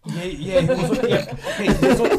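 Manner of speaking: high, loud, laughing through the words; amused and breaking into laughter; laughing agreement. Real, not acted. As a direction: crack up while agreeing. Amused reply to a joke.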